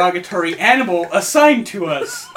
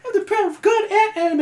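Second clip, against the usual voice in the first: high-pitched anime battle cry; mock high-voiced war cry; screeching anime kid; 14 semitones higher, faster than usual. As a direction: shriek it like a kid playing hero. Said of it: "so he's like".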